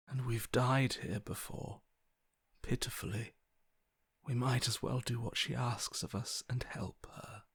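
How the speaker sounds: a tempting whisper; soft seductive whisper. Acted. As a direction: coax them under your breath.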